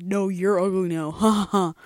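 loud taunt with a laugh; mock gloating comeback. Punching back at someone who called you ugly, loud playful gloating. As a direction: throw it back, laughing loudly. Acting out an imagined comeback to an insult.

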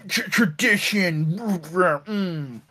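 sputtered, halting mock protest; reluctant grumbling. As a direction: imitate stammering, grudging objections. Retelling siblings' reluctant giving in.

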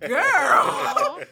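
loud, high-pitched holler; big laughing exclamation; excited outburst. Real, not acted. Excited reaction retelling the greeting.

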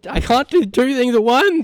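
flustered protest. Real, not acted.

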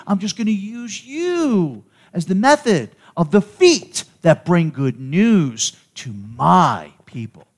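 high, loud, impassioned preacher; booming sermon climax, stretching the key words; full-force preaching. Acted. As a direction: drive it home from the pulpit at a shout.

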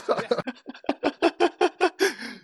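real high-pitched laugh; long high giggle; high burst of laughter. Real, not acted. Laughing at her own coyness.

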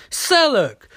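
a sudden high shout of the name; loud high call for Sellook. Acted. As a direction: yell the name across the room. Canary calls out for Sellook.

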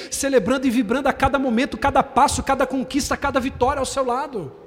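cada vez mais alto e vibrante; pregação em crescendo. Real, not acted. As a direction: levante a voz a cada conquista. (pregação vibrante e forte)

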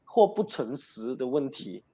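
慢慢地把外遇和不诚实问题一字一字念出来; 强调"外遇"，放慢语速，像在划重点; slow pointed quote. Shown as a demonstration. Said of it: "我发现到这里有一个点哦". (主持人放慢语速，强调信里的重点)

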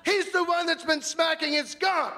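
shrill high blurt. Retold or imitated. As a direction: squeal it out before you can stop. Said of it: "this came out".

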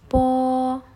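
"po" held long, high and level; pronunciation demo. Shown as a demonstration. Teacher to students, how the consonant sounds, drawn-out demo. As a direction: draw out "po" on one high, loud note. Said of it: "cara pengucapannya".

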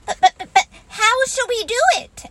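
high, fast stammering little pig; a small, timid piglet fretting out loud; nervous Piglet. Acted. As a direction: squeak it out anxiously, tripping over the words. Piglet's squeaky anxious voice.

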